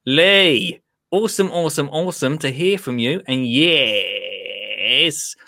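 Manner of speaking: hammed-up, enthusiastic Australian; Australian accent. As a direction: put on an Australian accent, drawing out the last word. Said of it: "I'll do the accent as well".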